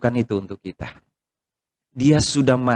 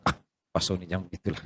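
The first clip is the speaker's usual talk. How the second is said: low, much softer murmur of objection; imitated soft protest; 7 semitones lower, 12 dB quieter, faster than usual. Congregant objects to the pastor, soft imitated protest. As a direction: drop right down and gently object.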